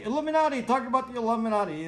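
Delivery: high, loud, fast mocking voice; mock request. Mocking a viewer who asked about the Illuminati, high mock voice.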